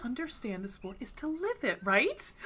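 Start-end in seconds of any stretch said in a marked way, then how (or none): none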